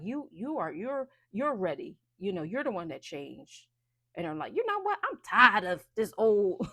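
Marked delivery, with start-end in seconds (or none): 4.5-6.7 fed up. Acted. Voicing the client who has had enough of struggling, fed up. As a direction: declare it fed up, slowing on the complaint. Voices the listener finally fed up.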